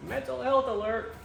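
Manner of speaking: mock alert. Mock announcement.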